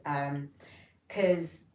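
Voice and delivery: normal talking voice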